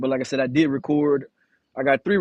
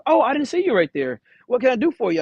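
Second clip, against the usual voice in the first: higher, polite lady voice, lightly done; mock-friendly office lady greeting; imitating the office lady; 8 semitones higher than usual. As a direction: pitch up to play the receptionist. Dispatch lady notices him.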